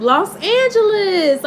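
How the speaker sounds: high, loud, slow city name; excited call. Real, not acted. Tarot reader calling out a location, excited. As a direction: sing out the city.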